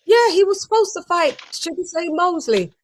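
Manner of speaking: high mock Tim voice; imitating Tim. Host mimics Tim not recognizing Shane. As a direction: repeat it in a high, clueless voice. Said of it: "Tim goes".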